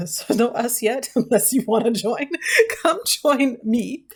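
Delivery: giggling through the words, slowing down; laughing. Real, not acted. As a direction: laugh at yourself while inviting. Laughing at her own slip.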